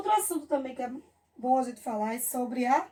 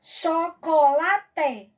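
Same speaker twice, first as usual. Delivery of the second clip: "Chocolate" very slow and louder, cho-co-la-te; syllable by syllable; 6 dB louder, much slower than usual. Shown as a demonstration. Teacher counting four syllables for pupils, slowly syllable by syllable. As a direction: punch out all four syllables of "Chocolate" separately. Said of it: "separar as sílabas".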